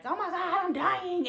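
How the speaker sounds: panicked. Retold or imitated. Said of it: "you're gonna be like".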